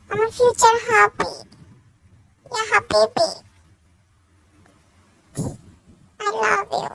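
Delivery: over-the-top loud sweet talk; loud fawning. Acted. Girlfriend loudly flattering her rich boyfriend for shopping.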